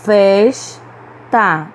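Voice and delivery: "Festa" split in two, strong Fes; stress on penultimate syllable. Shown as a demonstration. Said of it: "pronunciamos com mais força a penúltima". ("Festa" silabado, com força em Fes)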